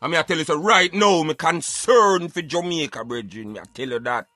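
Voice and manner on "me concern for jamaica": ordinary talk, nothing marked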